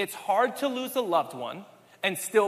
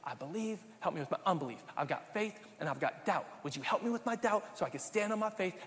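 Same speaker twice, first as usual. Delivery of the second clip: quiet plea; 8 dB quieter, faster than usual. Acted. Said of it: "What does Jesus do in Mark nine?".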